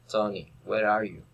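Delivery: ordinary talk, nothing marked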